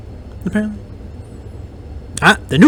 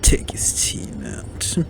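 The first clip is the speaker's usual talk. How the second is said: slow whispered order; whispering; 10 dB quieter, much slower than usual. Real, not acted. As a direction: whisper it slowly, conspiratorial. Quiet tactical callout in game.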